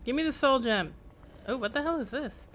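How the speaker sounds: normal talking voice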